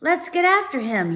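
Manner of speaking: high, loud eager squeal; excited boy squealing. A narrator's reading. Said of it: "he squealed".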